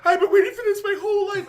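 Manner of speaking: high, loud, fast gush with a laugh; excited gush. Retold or imitated. A fan meeting her hero, high, loud laughing gush. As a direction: squeal it out like a thrilled fan.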